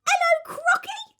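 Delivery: high, bright monkey chirp; cheeky monkey voice. Acted. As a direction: play a chirpy little monkey.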